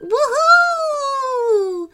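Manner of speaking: very high, long, gleeful whoop; playful squeal. A narrator's reading. Girls sliding down the pit, playful squeal. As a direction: squeal like a child on a slide. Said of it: "turned into playful squeals".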